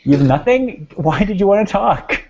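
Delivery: high, loud incredulous question; teasing disbelief. Real, not acted. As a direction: push back, amazed and teasing.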